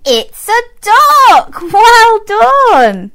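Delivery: high, slow, excited praise; reading to a toddler. Acted. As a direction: gush over a toddler's guess.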